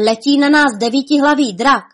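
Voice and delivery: high, loud cry of warning; alarmed shout. A narrator's reading. Sharp-eyed Kuba warns Honza of danger, alarmed shout. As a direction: shout a warning to your friend. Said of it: "vykřikl bystrooký Kuba Hnipírek".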